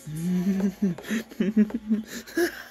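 high unhinged laugh; mad laughter. Acted. Psycho laughing madly back. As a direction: laugh like you've lost it.

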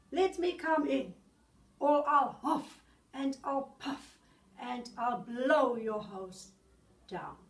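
loud booming wolf call. Acted. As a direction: call out like the big bad wolf at the door. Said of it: "said the wolf".

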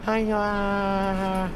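long, low, loud drawl; drawn-out reply. Acted. Woken passenger answering the taxi driver, drawn-out reply. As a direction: stretch the word out low and loud.

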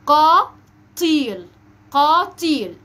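very slow "قاتيل" in two held syllables; loud, drawn-out model of "قاتيل"; qaf before t. Shown as a demonstration. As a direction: stretch "قاتيل", heavy q then clear t. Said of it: "هنقول إيه؟".

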